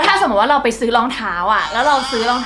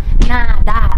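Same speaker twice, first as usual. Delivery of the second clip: "หน้าด้าน" barked out very slowly and much louder; shouted slow insult; 10 dB louder, slower than usual. Shown as a demonstration. Teaching the insult "หน้าด้าน" to a friend, slow loud shout. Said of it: "คือคำว่า".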